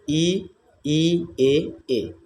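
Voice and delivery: each front vowel ई and ए drawn out and held clearly; vowel demo. Shown as a demonstration. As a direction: sound out ई and ए slowly for the class. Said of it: "जैसा कि आप देख रहे हैं". (स्वर ई और ए को खींचकर स्पष्ट बोलना)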